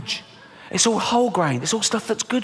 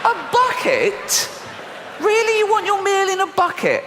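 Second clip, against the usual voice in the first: high, stretched disbelief at a bucket order; shocked, much higher, drawing out the question; incredulous; 14 semitones higher than usual. Pretend. Shop worker gaping at a customer, incredulous.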